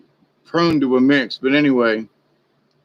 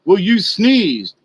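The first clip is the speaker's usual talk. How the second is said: accuser voiced; 5 semitones higher, 3 dB louder, slower than usual. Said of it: "somebody said".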